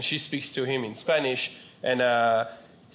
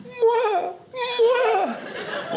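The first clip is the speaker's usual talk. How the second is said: put-on high-pitched French baby wail "mwah"; exaggerated French sob, high and drawn out; mock French-accented crying; 3 dB louder than usual. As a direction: cry "mwah" as a caricature French toddler. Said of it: "he cries with a French accent".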